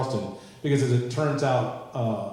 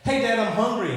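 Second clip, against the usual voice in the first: put-on higher little-girl whine; lightly voiced hungry kid; 9 semitones higher, 4 dB louder than usual. Friend Lily telling her dad she's hungry, higher kid voice. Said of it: "she's like".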